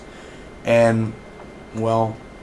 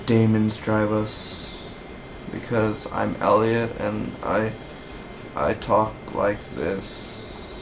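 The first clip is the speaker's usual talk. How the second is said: Elliot's mumble; 5 dB quieter, slower than usual. Retold or imitated. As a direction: drag it out, slow and subdued like Elliot. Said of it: "That's how he talks".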